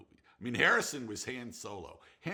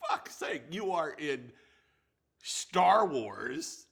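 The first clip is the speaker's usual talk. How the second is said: emphatic, slowed-down urging, louder; half-mock pep talk to a friend; retold exasperated rant; 4 semitones higher, 5 dB louder, slower than usual. Director urging an actor friend, emphatic and exasperated. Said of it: "I was just saying".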